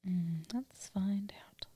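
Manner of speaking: soft whispered aside; breathy hushed whisper. Real, not acted.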